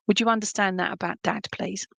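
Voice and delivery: ordinary delivery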